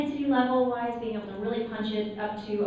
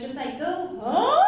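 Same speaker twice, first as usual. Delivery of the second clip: high vocal leap; 6 semitones higher, 4 dB louder, slower than usual. Shown as a demonstration. Voice teacher urging a student to go high, sudden high loud oh. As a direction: jump way up on oh. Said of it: "I'm like".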